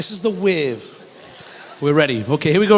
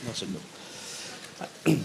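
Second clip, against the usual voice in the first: whisper; 10 semitones lower, 15 dB quieter, much slower than usual. Real, not acted. Echoes own line under breath.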